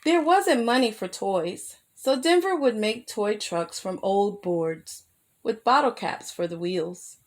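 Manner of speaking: plain reading voice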